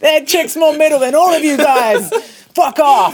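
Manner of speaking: loud, high hammed-up heckle; mock-aggressive yell over laughter; rowdy metal fan. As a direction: shout down the crowd as a metalhead. Imitates impressed metal crowd shouting.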